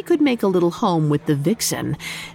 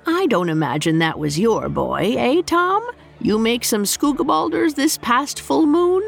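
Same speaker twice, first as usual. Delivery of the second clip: innkeeper voice; 6 semitones higher than usual. Acted. Innkeeper teasing the cat.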